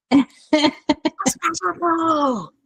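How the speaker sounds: tearful awe. Retold or imitated. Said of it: "the part where it's just like".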